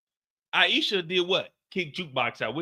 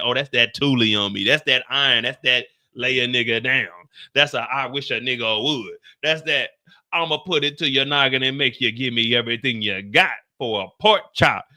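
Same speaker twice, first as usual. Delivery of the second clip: low, swaggering boast; low thug brag; 8 semitones lower than usual. Retold or imitated. Juke bragging about the gun, low swagger.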